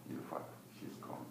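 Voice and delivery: a soft, breathless whisper of bad news; hushed news. A caller whispering the sad news of her death. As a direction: barely voice the words.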